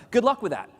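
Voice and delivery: high, loud breezy brush-off; mock breezy dismissal. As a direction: wave it off cheerfully. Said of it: "the U S American's like".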